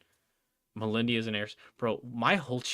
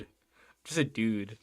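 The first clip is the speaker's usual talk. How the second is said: ordinary delivery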